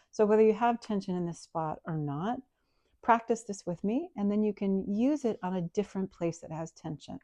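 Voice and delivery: ordinary talk, nothing marked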